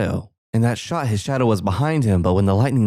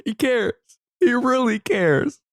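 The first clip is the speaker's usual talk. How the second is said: high, slow mock-emotional; mock touched; 13 semitones higher than usual. Viewer mock-moved by the father, high and slow. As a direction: gush as if moved to tears.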